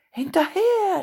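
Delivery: high, loud shout after him. A narrator's reading. Bulli shouts to his friends to chase Lasse, loud high yell. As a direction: yell to send the gang chasing. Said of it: "schrie Bulli".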